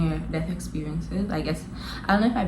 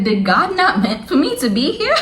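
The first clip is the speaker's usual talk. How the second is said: high, loud mock disbelief; raised-pitch comic incredulity, louder; incredulous question; 6 semitones higher, 5 dB louder than usual. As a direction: exclaim it like a baffled punchline. Incredulous punchline, then laughs.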